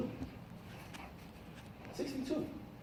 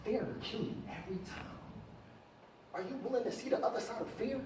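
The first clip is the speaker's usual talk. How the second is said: quiet; 12 dB quieter than usual. Real, not acted. Dropping to intense quiet.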